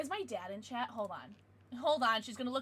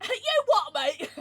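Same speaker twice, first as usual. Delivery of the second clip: mock British accent; 6 semitones higher, 8 dB louder, much slower than usual. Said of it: "my offensive British accent".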